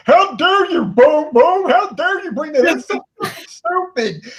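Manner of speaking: high, loud, outraged mock tirade; hammed-up squealing indignation; Coco. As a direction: imitate an indignant co-host at a high pitch. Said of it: "And Coco's like".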